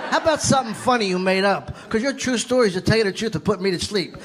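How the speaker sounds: ordinary talk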